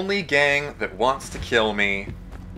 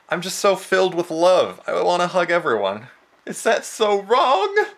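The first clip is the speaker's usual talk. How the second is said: mock gushing; 10 semitones higher, 4 dB louder than usual. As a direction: squeal it out like an overcome sap, ending in a fake sob. Mock sentimental outburst while playing.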